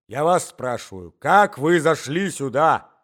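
loud, slow, insistent questioning; stern demand. A narrator's reading. Old husband demanding how intruder got in, stern demand. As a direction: build to a drawn-out loud demand.

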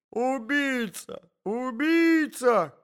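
high, long-drawn melodramatic cry; lady's cries. A narrator's reading. Lady accusing the man under bed, crying out. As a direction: wail the accusation, stretched out slowly. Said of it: "кричала дама".

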